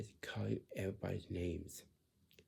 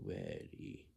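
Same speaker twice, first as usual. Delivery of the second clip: very low, slack, drawn-out mumble; mock a lazy answer, voice dropped right down. Adult showing how not to answer Heather, lazy low drone. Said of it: "You don't sound like this".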